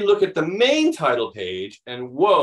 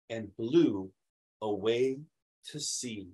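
soft, slow storybook ending; hushed read-aloud finish; reading to children; 5 semitones lower, 7 dB quieter, slower than usual. Acted. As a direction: let the last words drift away quietly. Reads the book's last page.

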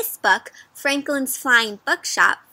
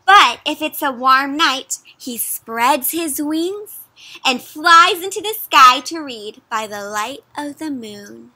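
storybook read-aloud; 4 dB louder than usual. A narrator's reading. Reads story text aloud.

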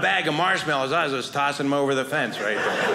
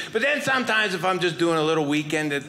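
ordinary talk

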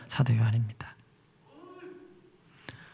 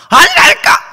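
desperate shout; 8 dB louder, slower than usual. Acted. Peter denying.

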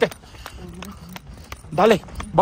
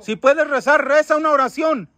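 calling out; 8 semitones higher, 3 dB louder than usual. Real, not acted. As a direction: call across a distance. Calling to a lost child spirit. (llamando a un niño perdido, en voz alta)

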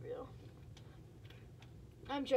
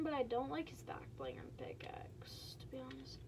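whispered aside; 9 dB quieter than usual. Real, not acted. Critical aside about a skin.

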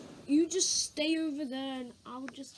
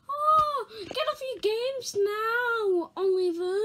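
high, slow mocking nag; mock the parent's nagging in a high voice; 7 semitones higher, slower than usual.

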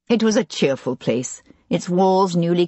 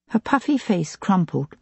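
plain reading voice

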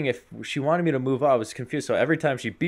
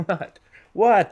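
high double take, louder the second time; mock startled; 10 semitones higher, 7 dB louder than usual. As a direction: blurt it high, then louder. Acting out startled reply to beeping.

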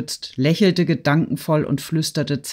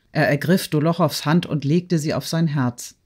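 usual speaking voice

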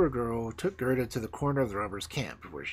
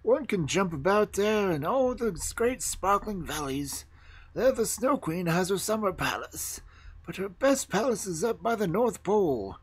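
higher, lilting animal voice; storybook reindeer, pitched up; talking reindeer; 8 semitones higher than usual. Acted. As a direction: describe home with relish as the reindeer.

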